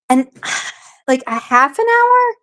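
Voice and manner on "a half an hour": pitched way up in disbelief; incredulous question. Real, not acted. Streamer recalling brief peace, high disbelieving question. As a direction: ask it high, as if it can't be true.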